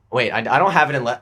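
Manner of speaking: ordinary delivery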